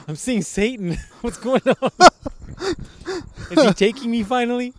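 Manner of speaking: high, loud voice breaking into laughs; hammed-up hallucinating drunk, tickled by the bit; mock drunk delirium. Pretends an olive has him hallucinating, mock drunk delirium. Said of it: "everything's spinning right now".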